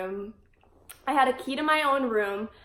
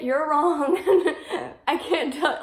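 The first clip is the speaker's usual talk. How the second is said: real laugh breaking into the words, higher pitched; 6 semitones higher, 3 dB louder, slower than usual. Real, not acted. Warning people who joke about jail food, laughing. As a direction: laugh your way through the warning.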